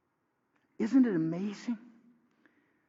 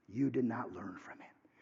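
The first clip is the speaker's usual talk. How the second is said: hushed, much softer rebuke; whisper; 7 semitones lower, 9 dB quieter than usual. Acted. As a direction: lean in and whisper the reproach. Preacher drops to whisper for rebuke.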